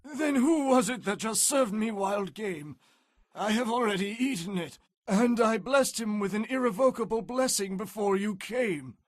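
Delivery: a frail father realizing the trick; old Isaac, mildly shaken. Acted. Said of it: "began to tremble uncontrollably".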